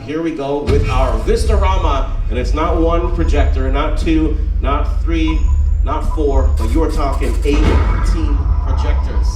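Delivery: loud, slow showman announcement; upbeat cruise director. Acted. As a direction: build the reveal like a showman. Big reveal of the Vistarama.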